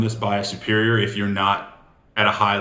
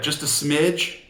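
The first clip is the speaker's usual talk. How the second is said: "smidge" lifted higher with a rising end, downplaying the cost; soft upward smidge; 5 semitones higher, much slower than usual. Shown as a demonstration. Salesman to homeowners, making the price gap sound tiny. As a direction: pitch "smidge" up and let it rise, like it's nothing. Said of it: "I always say it like that".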